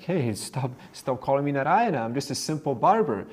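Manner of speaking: a barber fed up with the praise; angry barber. Acted. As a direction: snap at him to stop it. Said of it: "this fella got really angry".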